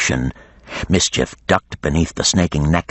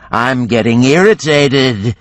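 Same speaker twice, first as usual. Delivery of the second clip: higher, louder, hammed-up bored dragon; mock weariness; 7 semitones higher, 5 dB louder, slower than usual. A narrator's reading. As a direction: sigh it like a put-upon giant. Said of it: "putting on a show of weariness".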